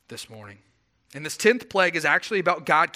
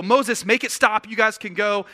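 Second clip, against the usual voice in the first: desperate Pharaoh; 5 semitones higher, 5 dB louder than usual. Retold or imitated. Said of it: "Pharaoh would go".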